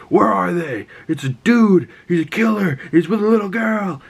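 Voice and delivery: high, urgent imitated search calls; mock hunters calling out. Hunters alerting each other to the player, mock calling out.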